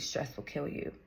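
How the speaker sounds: hushed, breathy retelling of mom's warning; mom's warning, whispered low. Retold or imitated. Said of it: "my mom told me at a young age".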